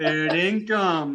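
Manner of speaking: slow careful "Fair dinkum", trying it out; trying out Aussie slang. Shown as a demonstration. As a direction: say "Fair dinkum" slowly, savouring a new phrase. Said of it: "That's a new one".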